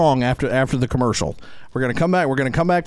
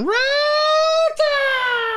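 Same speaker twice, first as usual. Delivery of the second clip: screaming Roll Tide. Retold or imitated. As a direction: shriek it like a crazed football fan. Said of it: "She comes out screaming".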